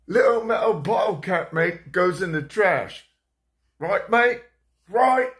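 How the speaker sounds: put-on high, loud London accent, rising to the end; a bossy London granddad in a London accent; grandfather's British voice. Grandfather with whiskey instructing grandson, high loud London accent. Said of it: "He goes".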